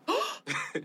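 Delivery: sharp surprised gasp; shocked gasp. Real, not acted. Host hearing about the test, shocked gasp. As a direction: suck in breath in surprise. Host reacts to guest's story.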